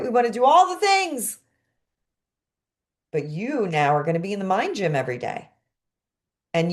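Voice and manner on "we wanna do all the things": high, playful burst of eagerness; mock overeager. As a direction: play the creative who can't choose. Acts out the overeager creative.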